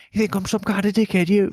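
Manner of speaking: high, loud imitated yell of a raging caller; retold angry scream. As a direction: ham up the furious van driver screaming down the phone. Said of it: "Fucking screaming down the phone".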